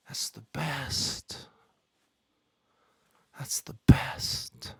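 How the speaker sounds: slow whisper; quiet whispered delight. Real, not acted. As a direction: let it fade to a breath. Repeated mock appreciation of the prank.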